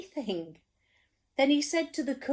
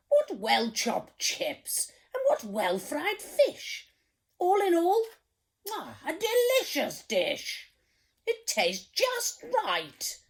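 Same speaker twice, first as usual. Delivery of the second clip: hearty praise; 9 semitones higher, 4 dB louder than usual. Acted. King praising the meal, loud and delighted. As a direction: relish every word.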